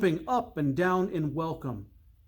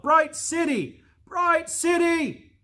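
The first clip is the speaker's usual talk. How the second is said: high, louder calling out; called out; 13 semitones higher, 5 dB louder, slower than usual. Acted. Littlechild calling out to Bright City through the fog. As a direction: call toward the distant city with all hope. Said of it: "called out".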